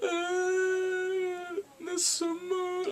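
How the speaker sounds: high, whiny fake wail; badly faked sobbing; mock crying. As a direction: imitate a tattling crybaby, drawn out and slow. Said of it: "she's like crying like".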